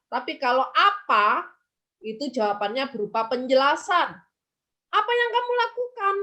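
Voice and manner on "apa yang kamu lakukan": mock question. Acted mismatched question exchange.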